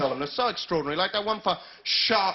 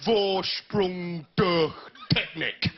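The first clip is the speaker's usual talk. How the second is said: slow, lofty German slogan read; a touch of pompous German advert voice; pretentious upper-class German; much slower than usual. Retold or imitated. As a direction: drawl it like a posh prat. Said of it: "as you say if you're a pretentious upper-class prat".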